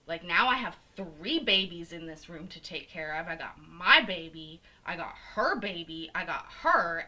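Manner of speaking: loud punched list of burdens; indignant rant. Retold or imitated. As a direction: reenact scolding the nurse, hitting key words. Acted rant at the nurse.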